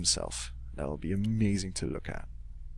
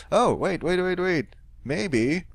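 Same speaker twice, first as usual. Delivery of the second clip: sudden excited idea, higher and louder; 8 semitones higher, 4 dB louder, slower than usual. Real, not acted. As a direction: wait wait wait, eureka. Sudden idea mid-game.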